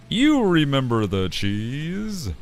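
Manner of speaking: ordinary talk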